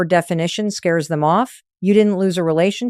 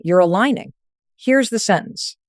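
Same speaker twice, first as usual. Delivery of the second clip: normal talking voice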